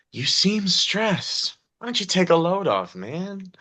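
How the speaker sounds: easygoing bartender. Acted. Bartender pushing a drink on a guest, easygoing and high. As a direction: coax a stressed customer to relax. Game master voices laid-back bartender Jimmy.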